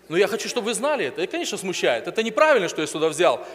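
ordinary delivery

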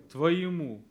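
ТВОЕМУ punched out loud, falling at the end; stand-alone emphatic «Твоему»; stressed answer. Shown as a demonstration. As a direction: hit «Твоему» hard as the answer. Key word emphasised after question.

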